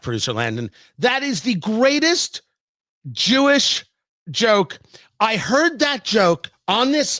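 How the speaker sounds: ordinary talk, nothing marked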